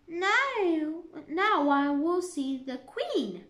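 Alice can't wait to see the Queen; eager. A narrator's reading. Alice eager to glimpse the Queen, excited.

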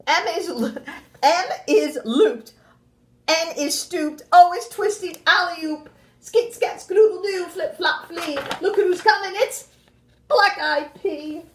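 lively, sing-song storybook voice, well above her normal pitch; animated read-aloud to a class; reading to children. Acted. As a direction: read it big and bouncy for little kids. Teacher reads picture book aloud.

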